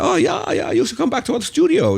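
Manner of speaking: high-pitched, badly imitated German accent. Techno hero inviting a visitor to his studio, bad high German accent. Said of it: "Sorry, my German accent is shithouse".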